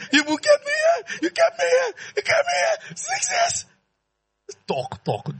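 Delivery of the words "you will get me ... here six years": whiny king. Acted. King whining after six years.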